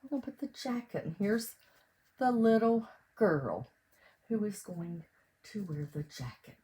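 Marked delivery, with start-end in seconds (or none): none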